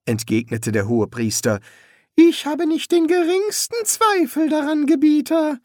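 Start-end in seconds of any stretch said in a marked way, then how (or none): 2.1-5.6 very high-pitched put-on priest voice. Priest addressing his young master, high put-on voice. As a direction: pitch the priest far above the narrator.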